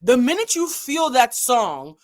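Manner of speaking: usual speaking voice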